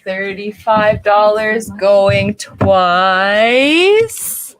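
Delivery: slow, drawn-out going twice, sold; milk each count, then sing out sold; auctioneer countdown. Acted. Auctioneer closing the sale.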